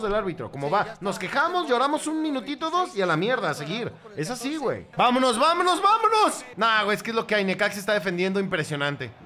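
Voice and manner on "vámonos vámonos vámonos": loud, high urging shout; urgent cheering. Real, not acted. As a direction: yell them forward, voice climbing. Urging the team on live. (grito de aliento urgente)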